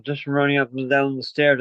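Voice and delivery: usual speaking voice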